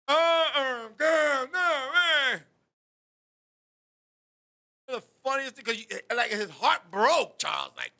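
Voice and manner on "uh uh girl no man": high mock wail, half-formed words; imitated upset deaf man. Deaf Bear still upset about Barbara, imitated protest. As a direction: protest loudly in mimicked cries. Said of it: "Bear's still upset to this day".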